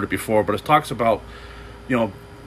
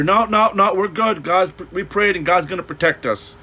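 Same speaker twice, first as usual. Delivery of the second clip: higher, insistent mock refusal; stubborn refusal; 7 semitones higher than usual. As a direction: brush off the rescuer stubbornly. Stubborn couple refusing rescue.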